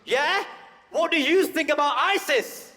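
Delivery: high, loud mocking voice of the guy; nosy stranger. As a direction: parody the man's pointed question. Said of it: "he followed up with this question".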